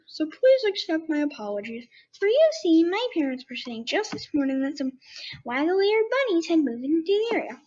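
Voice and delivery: wavering pitch, rising and falling; fluctuating voice. Acted. Jingle the bear explains, in a fluctuating voice. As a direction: let the voice wobble up and down. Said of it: "the voice I'm gonna try to do for him".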